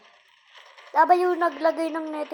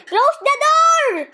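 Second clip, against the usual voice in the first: loud, higher, slow shout; yelling; 12 semitones higher, 12 dB louder, slower than usual. Real, not acted. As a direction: yell at the sister to shut it. Yelling at sister.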